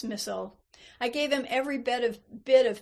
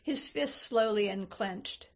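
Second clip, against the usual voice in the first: usual speaking voice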